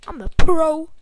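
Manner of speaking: a booming, far-off brag; loud boast. Real, not acted. Gamer crows to the stream in a booming brag. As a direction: shout it like a champion.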